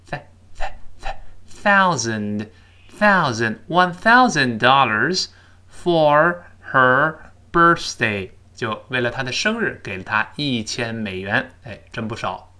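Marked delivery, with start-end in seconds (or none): 0.1-3.9 "th-" repeated very slowly, tongue brushing the upper teeth, then "thousand"; drilled th. Shown as a demonstration. Teacher drilling the th sound for students, very slow. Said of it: "舌尖吐出来一点儿…跟上牙轻轻蹭一下". 5.7-8.3 "for her birthday" very slow with a clear th; careful birthday. Shown as a demonstration. Teacher reading slowly to show the th in birthday. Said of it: "别忘记中间th看到了".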